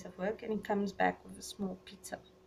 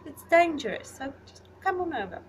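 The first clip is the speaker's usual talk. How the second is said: urging invitation; 8 semitones higher, 5 dB louder than usual. Retold or imitated. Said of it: "And I'm like".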